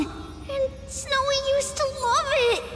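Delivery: high, excited lilt; delighted reminiscing. Acted. Old friend recalling a beloved song, delighted. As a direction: pitch it up with fond delight.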